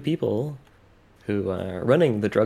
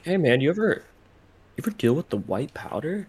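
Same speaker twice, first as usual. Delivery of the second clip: laid-back surfer dude voice, pitched up; surfer guy voice; 6 semitones higher than usual. Acted. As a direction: talk like a chill beach surfer. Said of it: "I sound like a surfer guy right now".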